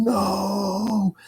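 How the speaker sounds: high, crying-out no before impact; panicked cry. Retold or imitated. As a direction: yell no as the car slides into the bumper. Bracing before a crash.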